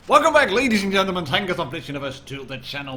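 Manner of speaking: fast, loud hype intro; speeding up to reveal. Real, not acted. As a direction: blast the welcome like an announcer. Channel intro after the reveal.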